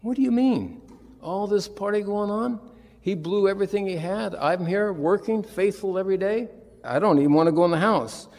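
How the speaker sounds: high, aggrieved complaining voice; indignant older son. Retold or imitated. Preacher voicing the angry older son's complaint. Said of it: "He's, he's thinking".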